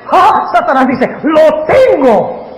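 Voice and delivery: a high, loud slow gloat. Acted. Preacher voicing Satan sure he has won. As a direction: savor the capture, stretch it out.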